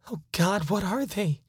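ordinary delivery

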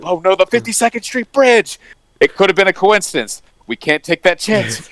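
high-pitched hammed-up panic; theatrical dread, pitched well up; mock alarm. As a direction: imitate officials panicking at a threat. Acting out panic at bridge blowing up.

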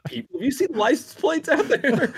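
high voice, laughing all through the question. Real, not acted. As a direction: giggle through the question, barely holding it together. Laughing while quoting the film.

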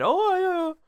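high, put-on Cockney "Oh, yeah" yelled up top; mock a Cockney lad yelling "Oh, yeah"; Cockney accent. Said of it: "in a Cockney accent".

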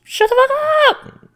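high, loud rapper shout; mock rapper intro yell. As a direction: bark it like a rap intro. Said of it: "his intro".

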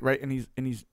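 ordinary talk, nothing marked

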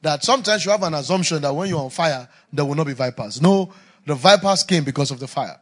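loud, driving sermon cadence; preaching at full force. Acted. Preacher exhorting the congregation, loud and fervent.

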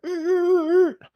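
high strained vehicle noise; imitated Humvee brakes screeching. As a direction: mimic the Humvee grinding to a halt. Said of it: "the Humvee's like".

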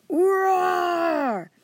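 loud, high scream of fright; high shouted roar. Acted. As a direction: scream it while diving for cover. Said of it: "we all screamed".

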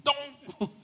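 high put-on whine of dismay; a pleading high "don't" to Peter; disciples groaning. Disciples begging Peter to stop, high whiny protest. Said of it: "Peter going".

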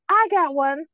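a hint of a proud little girl; sassy kid. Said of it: "my sassy little girl".

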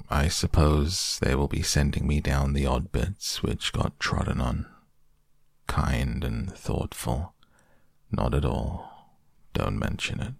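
low, soft, gloomy donkey voice; low sorry-for-himself mumble. Acted. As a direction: mope through it like Eeyore.

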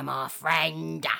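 low, menacing dog threat; dog sizing up the parrot. Acted.

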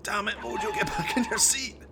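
a driver yelling over his shoulder; mock yell. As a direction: snap at him to sit down. Car swerves, Jones yells.

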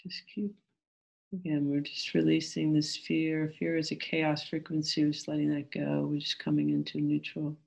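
calm, gentle meditation guide. Acted.

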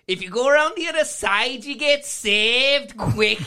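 high, loud, dragged-out mock Liverpool accent; Liverpool cafeteria lady. Storyteller imitating a counter lady, high loud Liverpool accent. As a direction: screech the advice in broad Liverpool. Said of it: "the lady behind the counter goes".

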